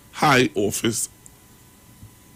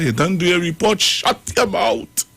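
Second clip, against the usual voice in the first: mocking the threat; 7 semitones higher than usual. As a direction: parrot the bully's threat scornfully. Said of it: "But hear what they say to her".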